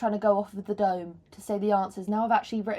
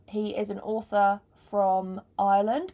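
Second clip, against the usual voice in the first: ordinary talk, nothing marked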